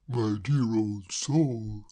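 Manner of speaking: put-on very slow, gentle old-chap comfort; soothing. Braddock comforting Claire, slow and gentle. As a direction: soothe her slowly.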